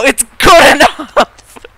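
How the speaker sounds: a long mock-triumphant shout of good enough; drawn-out shout. Shown as a demonstration. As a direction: yell it out, stretching good enough. Repeating motto good enough.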